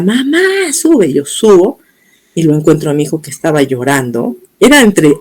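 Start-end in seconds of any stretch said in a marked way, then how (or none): 0.0-1.1 high-pitched yell, held back in volume; imitated call-out. Daughter calling her mother upstairs, mock yell. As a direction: mimic a child calling upstairs urgently without real loudness. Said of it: "mi hija me grita".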